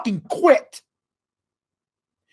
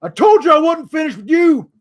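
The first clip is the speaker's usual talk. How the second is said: higher, louder mock villain line; hammed-up revenge promo; heel wrestler threat; 7 semitones higher, 5 dB louder than usual. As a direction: snarl it as a vengeful wrestler. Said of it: "Have him say".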